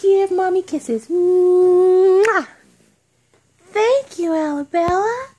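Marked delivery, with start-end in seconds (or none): 1.1-2.5 big smacking kiss; loud kiss smack. Real, not acted. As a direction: blow a loud noisy kiss. Mother kissing her baby.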